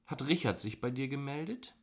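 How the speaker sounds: plain reading voice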